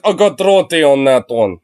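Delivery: mock Russian accent. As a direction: imitate a Russian auction bidder. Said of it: "this random Russian over here".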